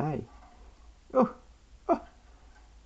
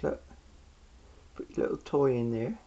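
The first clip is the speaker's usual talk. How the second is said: normal talking voice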